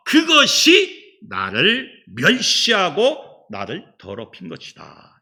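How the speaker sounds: preacher driving the point with repetition; building sermon. Acted. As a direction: hammer each phrase home. Rising preached list.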